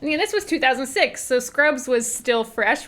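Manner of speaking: ordinary talk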